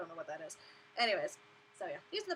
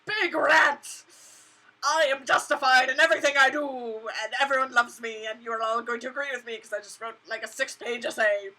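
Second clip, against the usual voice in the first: loud, pompous self-justifying; mocking Mer Taylor's loud self-righteous rant; 11 dB louder than usual. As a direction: ham up a smug ranting designer. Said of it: "he's like".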